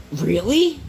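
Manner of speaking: high, rising mock-amazed question; surprised really. Classmate hears he can power a house, a surprised high really. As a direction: sound amazed at the news. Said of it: "And he goes".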